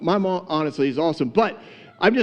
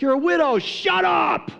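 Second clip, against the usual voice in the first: hammed-up mocking taunt in a much higher voice; mocking men; 12 semitones higher than usual. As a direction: sneer at her like a bully. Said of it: "And they were like".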